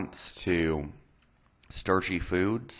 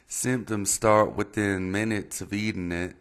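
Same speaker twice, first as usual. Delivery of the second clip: put-on Texas accent; 4 semitones higher than usual. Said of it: "excuse the Texas accent".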